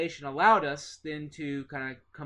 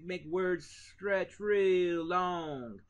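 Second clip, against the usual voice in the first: very slow Southern drawl, every word stretched out long; a teacher slowing to a long Southern drawl to show the accent; 2 semitones higher, much slower than usual. Shown as a demonstration. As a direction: drag each word out like a lazy Southern drawl. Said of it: "where you talk real slow".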